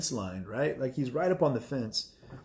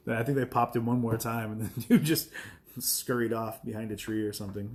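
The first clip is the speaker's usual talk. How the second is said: ordinary delivery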